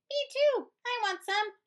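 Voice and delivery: excited high little critter; eager high voice. Acted. Prairie dogs wanting fuzz, high and eager. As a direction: squeal it out, wanting some.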